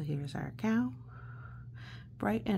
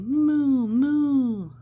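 playful cow moo; rising mock moo; louder moo; 7 semitones higher, 9 dB louder than usual. Shown as a demonstration. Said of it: "you could just say moo".

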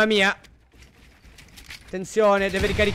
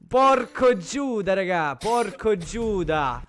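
drawn-out amazement; much slower than usual. Real, not acted.

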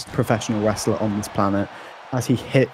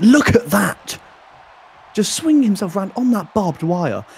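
excited; 8 semitones higher, 3 dB louder than usual. Real, not acted. Commentator reacts to barbed wire stunt.

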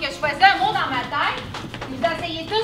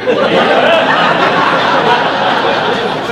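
big burst of laughter; laughing hard at the joke; loud laugh; 4 semitones lower, 6 dB louder than usual. Real, not acted. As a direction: let out a loud breathy laugh. Charades game, sudden laughter.

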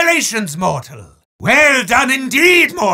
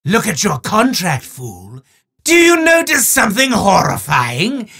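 grand, theatrical villain proclaiming to a mortal; booming mock-sinister showman, with quick legal asides; hammy demon host. Demon Marasmus handing a prize to a mortal, hammy menace.

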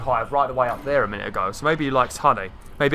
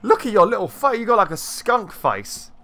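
gushing cooing; 8 semitones higher than usual. Real, not acted. Player talking to an adorable animal, gushing baby talk. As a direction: coo over an adorable little creature.

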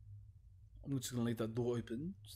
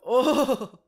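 sharp high loud cry of fright; frightened shout; 11 semitones higher, 14 dB louder than usual. Real, not acted. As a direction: shout as if something jumped out. Game starts, sudden fright.

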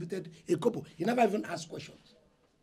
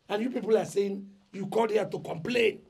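normal talking voice

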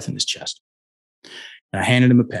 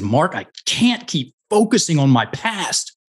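acting out a struggling client, higher and emphatic; exasperated client outburst; 6 semitones higher than usual. Young man tells his trainer what holds him back, exasperated. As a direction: imitate his strained complaint, ending on a rise. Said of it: "this is what he said ... and this is what he did".

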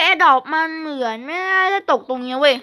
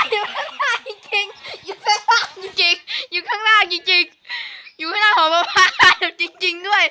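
slow, high, laughing through words; nervous laugh while repeating; laughing in disbelief; 5 semitones higher, slower than usual. Real, not acted. Laughs after spotting the ghost. (หัวเราะอย่างไม่อยากเชื่อ)

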